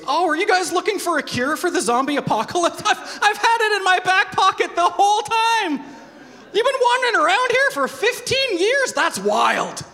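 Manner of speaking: high, loud, laughing mock kid voice; squeaky, laughing, hammed-up kid; clueless kid voice. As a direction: play the oblivious kid who had the cure all along. Mocking a zombie-cure hoarder.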